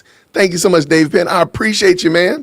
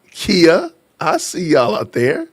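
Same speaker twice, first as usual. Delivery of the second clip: ordinary delivery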